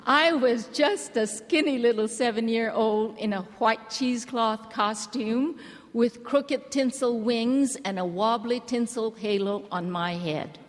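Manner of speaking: ordinary talk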